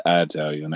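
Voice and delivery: Italian said as EYE-tal-yun; long-I pronunciation. Shown as a demonstration. Co-host quoting a way never heard, long-I pronunciation. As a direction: open "Italian" on a clear eye sound. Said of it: "never heard anybody pronounce it".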